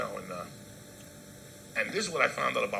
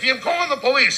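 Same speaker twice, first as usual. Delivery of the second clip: much higher and louder busybody voice; mocking a bystander lady; 13 semitones higher, 12 dB louder than usual. Mocking the bystander lady who threatens police, high loud mock. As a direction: squawk it like a nosy woman. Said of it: "some lady's like".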